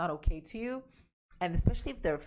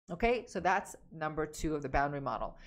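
normal talking voice

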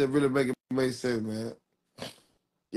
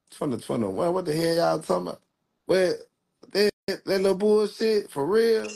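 can't believe what he's hearing, voice climbing up; incredulous disbelief; 7 semitones higher than usual. Real, not acted. Ex-prisoner scoffing at a case from twenty twenty-one, incredulous and rising higher.

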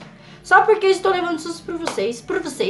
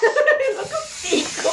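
shrieking nervous laugh, very high; 7 semitones higher, 6 dB quieter than usual. Real, not acted. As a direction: squeal with laughter from fright. Nervous laugh during scare. (risada nervosa e aguda)

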